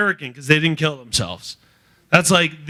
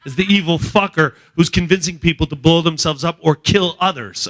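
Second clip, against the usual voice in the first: ordinary talk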